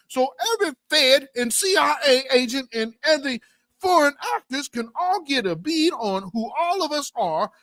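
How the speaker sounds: a mock organizer urging everyone to march; mock rally call. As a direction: rouse the crowd like a mock organizer. Mocking marchers rallying the crowd.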